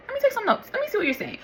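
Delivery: very high, light and bright; mock sweet openness. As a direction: play the new calm self. Now reacting calmly to criticism.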